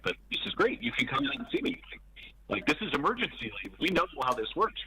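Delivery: high, bright reassurance to his son; upbeat reassurance. Retold or imitated. Telling son, upbeat reassurance, voice raised.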